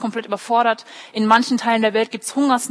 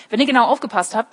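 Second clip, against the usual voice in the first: usual speaking voice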